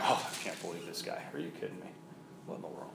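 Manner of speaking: a churchgoer's quiet disbelieving mutter; muttered scoffing. Acted. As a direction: grumble it half to yourself from the back pew. Scoffer muttering in the back pew.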